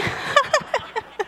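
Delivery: laughing out high at the punchline; real laughter at the joke. Real, not acted. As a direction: burst out laughing at your own joke.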